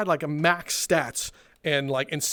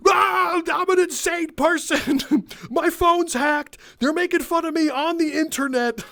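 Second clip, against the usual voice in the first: crazy customer; 10 semitones higher than usual. Retold or imitated. Crazy customer's paranoid rant.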